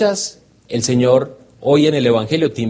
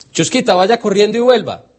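higher, eager order to the dog; child's command; 9 semitones higher, 3 dB louder than usual. Retold or imitated. A little boy sends the dog off running, higher. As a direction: call out like an excited boy.

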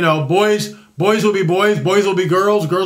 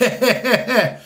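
short real chuckle; a brief honest laugh; amused laugh; 3 semitones higher than usual. Real, not acted. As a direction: laugh at the cliché. Laughs at his own quote.

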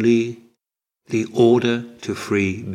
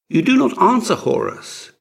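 higher, louder puzzled question; surprised; 9 semitones higher, 4 dB louder than usual. A narrator's reading. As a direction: ask in surprise at the silence. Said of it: "asked Ramses' messenger in surprise".

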